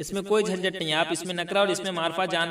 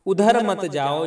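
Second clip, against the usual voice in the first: stern warning; 9 dB louder, slower than usual. Shown as a demonstration. Forbidding example.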